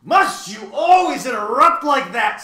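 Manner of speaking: loud, high irritated shout; exasperated yell at an interrupter; irritable. A narrator's reading. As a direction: snap it at full volume, exasperated. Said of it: "said Tok irritably".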